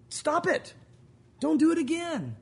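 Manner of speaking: high, emphatic mock warning; playful stern reprimand; acted parental scolding. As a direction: give the kids a firm final telling-off. God as parent ends the scolding.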